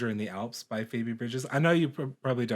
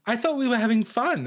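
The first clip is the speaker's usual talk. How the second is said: put-on whiny boyfriend voice; 10 semitones higher, 6 dB louder than usual. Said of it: "he's like".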